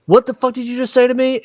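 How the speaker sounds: rapid, high-pitched mock offense; pissy. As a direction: act out a mildly pissy comeback. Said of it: "she gets really pissy".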